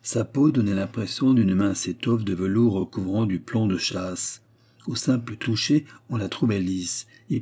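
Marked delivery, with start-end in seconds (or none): none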